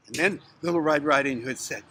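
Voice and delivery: plain reading voice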